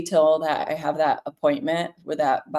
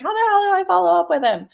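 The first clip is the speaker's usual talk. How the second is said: much higher, fast, exasperated East-less fluster; mock panicked newbie; 13 semitones higher, 4 dB louder, faster than usual. Voicing a new agent's panicked question, mock fluster. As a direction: rattle it off like a frazzled beginner. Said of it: "you're probably wondering".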